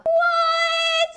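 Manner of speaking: shrieked high, startled what; a sudden shriek at the ghost; shocked. Acted. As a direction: jump out of your skin. Ghost revealed in seat.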